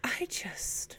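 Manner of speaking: breathy exasperated whisper. Real, not acted. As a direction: whispers in disbelief.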